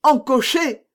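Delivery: high, loud cry of astonishment; astonished outburst; amazed exclamation. A narrator's reading. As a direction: exclaim it in disbelief. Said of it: "s'écria Mazarin".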